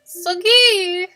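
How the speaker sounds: loud, high, stretched-out call; exclaimed name. Real, not acted. Streamer exclaiming at donor Soggy, exasperated.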